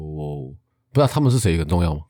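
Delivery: ordinary talk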